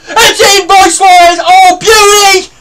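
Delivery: high, loud elated shouting; goal celebration. Real, not acted. Gamer cheering his striker's goal, loud and elated. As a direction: roar the goal home.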